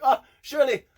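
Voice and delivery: high, loud swoon over "Shirley". Real, not acted. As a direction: gush like a fan.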